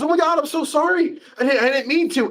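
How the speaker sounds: high-pitched mock-distressed apology; flustered apology. Magician to the girl crying all over again, high flustered apology. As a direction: apologize in a hurry to undo the harm.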